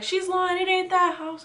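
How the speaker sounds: a hint of a stern dad snapping she's lying; mock dad. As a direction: call out the lie like an angry father. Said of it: "my dad was like".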